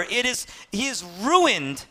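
ordinary delivery

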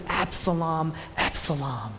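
low, soft, slow lament; a grieving father's hushed mourning, words spaced out; understated imitation of weeping over a son; acted weeping grief. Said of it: "He's weeping. He's crying".

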